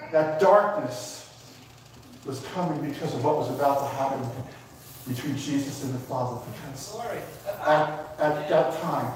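low, hushed, choked-up preacher; emotional. Real, not acted. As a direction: hold back emotion as your voice drops. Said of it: "I'm sorry I'm emotional".

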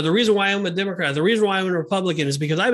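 quick, run-together sample excuses; rattled-off explanation. Retold or imitated. People explaining their politics, imitated in a hurried rattle. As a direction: imitate people reeling off their reasons, fast.

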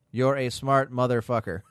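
ordinary talk

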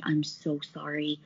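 mock hushed sympathy, voice dropped; retold apology. Office staff to the yelled-at rep, softly apologetic.

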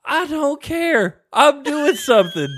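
high, loud, drawn-out shout; exasperated parent yell. Acted. Parent refusing to referee the kids, exasperated yell. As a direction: holler it slowly, each phrase stretched.

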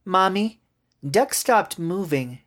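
put-on high, slow child's voice; drawn-out high little-kid report; worried little girl. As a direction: tell Mom the bad news as a small child.

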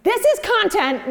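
high, loud outraged yell; mock rage. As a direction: blurt it out in comic, self-righteous fury. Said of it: "from a place of rage".

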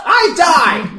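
very high, loud, drawn-out protest; indignant mock yell. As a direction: shout it in hammed-up outrage. Mock quarrel over who saved whom.

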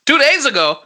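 high, loud echo question; stunned, asking back; surprised. Real, not acted.

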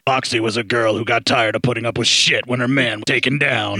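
lightly voiced trailer announcer; a hint of a grindhouse trailer narrator; movie trailer voice. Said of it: "Normally he would just be like".

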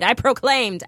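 ordinary delivery

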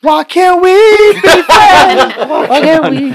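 high, hammed-up comic plea; over-the-top begging for friendship; mock pleading wail. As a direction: wail it way up high, breaking into laughter. Player jokes as pleading character.

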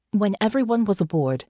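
ordinary delivery, nothing marked

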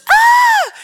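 sudden high shriek, loud; retold shriek. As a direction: let out a startled scream of alarm. Friend's skirt caught in escalator.

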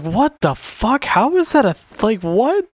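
baffled disbelief. Retold or imitated. Host recalling his reaction to the clown story, baffled. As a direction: react as if you can't process it.

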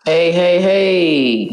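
firm, wary phone answer; bill collector voice. Pretend. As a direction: answer like a debt collector is calling. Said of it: "That's my bill collector voice right there".

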